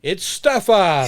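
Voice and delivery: slow hammed-up announcement shout; announcing shout. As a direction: announce him like a ringmaster. Announcing co-host pirate style.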